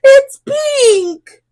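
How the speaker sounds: high-pitched, loud shocked cry; shocked shout. A narrator's reading. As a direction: cry out in horror. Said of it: "shouts Smoky".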